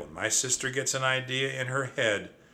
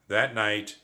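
plain reading voice

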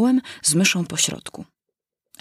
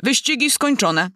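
calling out; 6 semitones higher than usual. Acted. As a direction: call out the race result to everyone. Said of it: "Drąt nagle zawołał".

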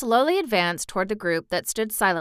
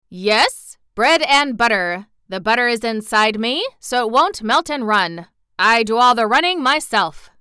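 louder, lively voice of a pompous little man; self-important bun man, joking; 3 semitones higher, 7 dB louder than usual. Acted. Bun man joking with the girl, proud and jolly. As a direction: deliver the joke with proud emphasis.

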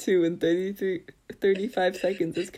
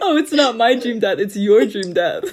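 laughing; 2 semitones higher, 7 dB louder than usual. Real, not acted. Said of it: "that's how I heard it".

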